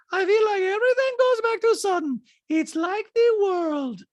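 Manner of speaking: high, loud mimicking voice; Diana. Diana complaining everything goes back to Sutton, high mock impression. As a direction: imitate her complaint way up high. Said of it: "Diana's like".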